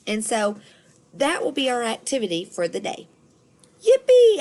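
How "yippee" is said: high, loud happy squeal; excited yippee. A narrator's reading. Junie B. cheering about making invitations, excited squeal. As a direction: burst out with joy. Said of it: "I said".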